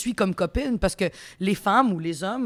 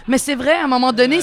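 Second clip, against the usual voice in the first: laughing; 4 semitones higher, 6 dB louder than usual. Real, not acted. Laughs at own quote.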